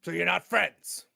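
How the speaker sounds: loud, slow, probing question; orc captain. Acted. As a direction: question them slowly in a big voice. Game master voices orc captain.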